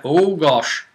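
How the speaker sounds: normal talking voice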